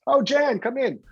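high, gushing welcome to a familiar face; delighted greeting. Retold or imitated. Fans welcoming [name] everywhere, high and gushing. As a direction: greet her like a beloved local star.

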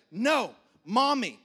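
put-on loud, slow toddler whine; mock a two-year-old pushing dad away; toddler refusal. Toddler son refusing his dad, loud and slow.

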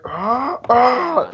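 high mock attack yells; two high "ah" cries imitating a leaping attacker; imitated battle cry.

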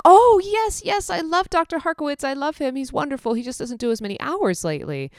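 high, bubbly voice of the doting client; gushing old client. Retold or imitated. Pet owner gushing about Doctor Harkowitz in a high voice. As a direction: gush about the beloved vet. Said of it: "And she's like".

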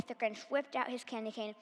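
ordinary delivery

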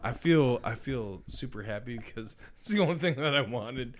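ordinary talk, nothing marked

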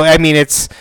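ordinary talk, nothing marked

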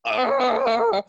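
high, loud held scream; stressed-out scream. Acted. Acting out his stressed wizard on the podcast, stressed scream. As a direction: let out one long, shrill-pitched yell of stress. Acts out stressed character's cry.

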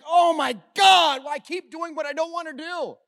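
a slow, loud cry of frustration, pitched high; anguished cry. Retold or imitated. Preacher voicing Paul's struggle as an anguished outcry. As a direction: shout out the apostle's despair. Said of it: "And he's like".

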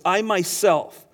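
"I myself" slowed, each word leaned on; stress on I myself. Shown as a demonstration. Preacher to congregation, slow weighty "I myself". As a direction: weigh "I myself" slowly and firmly. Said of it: "notice how he says this".

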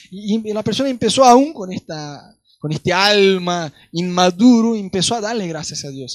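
ordinary talk, nothing marked

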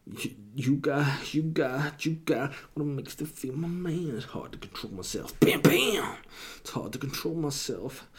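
husky, soft, seductive rapper croon. Pretend. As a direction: purr it like a smooth rapper. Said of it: "as if I am [name]".

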